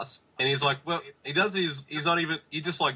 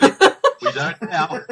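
high squealing laugh through words; 11 dB louder, slower than usual. Real, not acted. Laughing while repeating.

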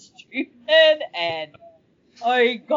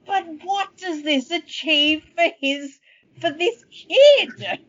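baffled protest; 7 semitones higher than usual. Acted. Baffled protest about the twist.

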